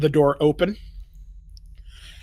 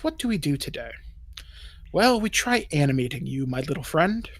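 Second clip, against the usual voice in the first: soft, lightly sing-song villain; half-sing it quietly to yourself; sing-songy; 6 dB quieter than usual. Acted. Elf addressing his experiment, softly sing-songy. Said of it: "almost kinda in a sing-songy voice".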